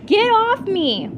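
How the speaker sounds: screaming at a licking dog, loud and high. A narrator's reading. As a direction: shriek at the dog. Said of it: "Jessie screamed".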